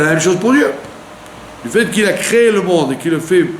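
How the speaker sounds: usual speaking voice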